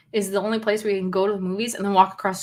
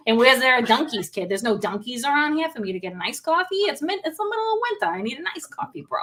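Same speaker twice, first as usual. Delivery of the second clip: higher, griping Boston local; Boston accent; 4 semitones higher than usual. Acted. Bostonian whining to a pal about iced coffee, Boston accent. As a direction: complain in a hammed-up Boston accent. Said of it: "wherever the Boston person is".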